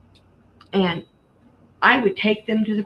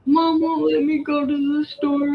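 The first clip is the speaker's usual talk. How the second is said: put-on bawling little girl; 8 semitones higher than usual. Daughter running to dad, mock bawling complaint. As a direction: whine to daddy like a crying kid. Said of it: "she started bawling and ran to her dad".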